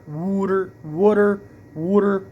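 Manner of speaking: Philly accent wooder; Philly water. Shown as a demonstration. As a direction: roll "water" out Philly style. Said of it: "I guess I say".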